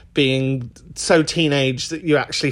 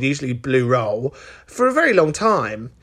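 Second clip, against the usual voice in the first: ordinary talk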